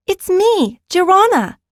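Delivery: high, bright announcement; child voice bursting with excitement; excited child. Acted. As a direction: shout it out, thrilled to be back. Girl announces herself excitedly.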